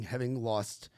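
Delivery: ordinary delivery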